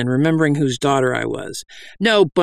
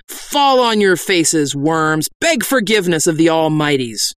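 commanding preacher; 3 semitones higher than usual. Acted. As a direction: command the sinners from above.

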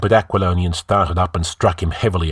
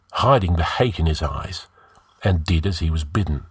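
ordinary delivery

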